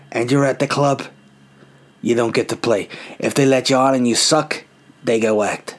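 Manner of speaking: lightly voiced mob boss, slowed down; a hint of a mafia don laying down rules; mafioso gangster. Club owner answering a listener as a mob boss, lightly voiced. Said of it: "as if I'm some sort of mafioso gangster".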